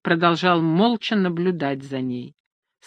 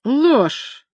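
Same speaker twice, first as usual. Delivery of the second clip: suppressed laugh; 8 semitones higher, 3 dB louder than usual. A narrator's reading. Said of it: "подавив смешок".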